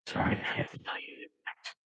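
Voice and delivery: whisper, barely voiced; whispered apology. A narrator's reading. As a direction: whisper it in grief.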